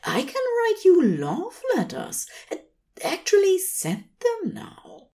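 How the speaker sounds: high, delighted angel; Aziraphale's voice. A narrator's reading. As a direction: glow with sudden joy.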